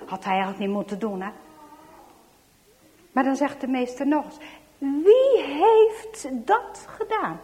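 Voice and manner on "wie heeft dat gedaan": slow, high, loud stern demand; acted schoolmaster's drawn-out interrogation; outraged teacher. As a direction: draw out each word, demanding a culprit. Teacher demands the culprit once more.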